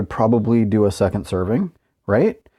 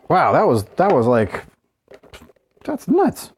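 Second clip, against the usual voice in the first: amazed; 6 semitones higher than usual. Real, not acted. Struggling with sealed container.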